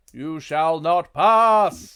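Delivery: slow, loud, thundering wizard; booming wizard. Acted. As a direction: bellow it slowly like Gandalf at the bridge. Wizard bursts out the door.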